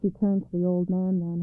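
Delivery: usual speaking voice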